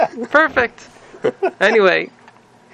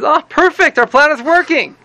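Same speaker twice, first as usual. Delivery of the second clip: mock delight; 9 semitones higher than usual. Rebbe delighted at the news.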